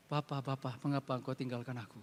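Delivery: soft, low, anguished cry to the Father; hushed plea. Retold or imitated. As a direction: barely voice the cry from the cross. Quoting Jesus's cry on the cross.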